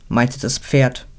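ordinary delivery

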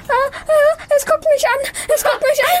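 high panicked cry; scared shriek. Acted. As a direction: shriek in fright at the creature. Boy sees creature in soup.

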